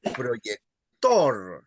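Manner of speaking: "proyector" with a long, heavy "tor"; teacher's model of "proyector", last syllable stressed; stress on final syllable. Shown as a demonstration. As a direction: land hard on the end of "proyector". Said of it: "you stress the last syllable".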